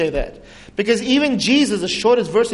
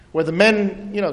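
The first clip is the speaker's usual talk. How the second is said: ordinary talk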